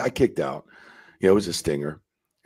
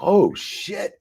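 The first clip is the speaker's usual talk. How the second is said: mock shock; 12 semitones higher than usual. Reacting to fine.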